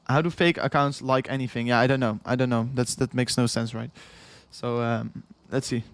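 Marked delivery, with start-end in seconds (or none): none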